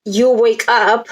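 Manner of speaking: ordinary talk, nothing marked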